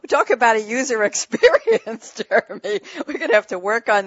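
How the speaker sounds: laughing out loud through the words; big loud laugh as she teases him. Real, not acted. Host laughing loudly, teasing Jeremy about his forgotten tea.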